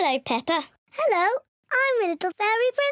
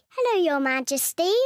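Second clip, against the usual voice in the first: ordinary delivery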